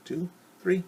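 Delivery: ordinary delivery, nothing marked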